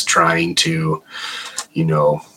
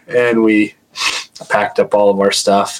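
ordinary delivery

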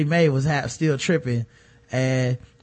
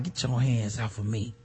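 quiet mock pushback; 7 semitones lower, 11 dB quieter than usual. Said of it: "And she was like".

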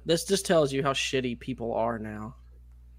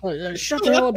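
higher-pitched mock scolding; 9 semitones higher, 4 dB louder than usual. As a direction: imitate an irate man shutting someone up. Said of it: "Just fucking berating him".

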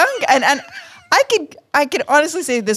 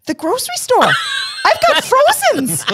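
put-on high-pitched, panicky Fargo accent. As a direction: blurt it out in a hammed-up Midwest accent. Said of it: "I started using a Fargo accent".

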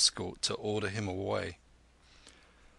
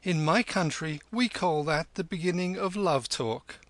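higher-pitched sweet taunt; mock sweetness; 7 semitones higher than usual. A narrator's reading. Kim sweetly taunting the hillman by the fire, mock-sweet. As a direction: needle him in a sweet voice. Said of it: "Kim's voice was sweeter than ever".

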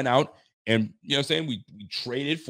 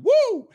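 a sudden very high, loud whoop; excited woo; 10 dB louder than usual. Real, not acted. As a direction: let out a hype yell of approval. Hyped celebration shout.

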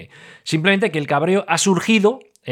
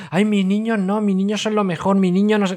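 higher, gushing mock parent voice; doting parent; 7 semitones higher than usual. Host mimics doting parents, higher and gushing. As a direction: play a besotted parent, a touch silly.

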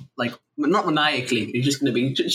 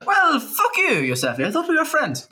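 mock offended; 10 semitones higher than usual.

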